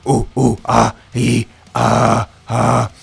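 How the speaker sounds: loud caveman vowel grunts; caveman sounds. Shown as a demonstration. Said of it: "that's the sound that I want you to make".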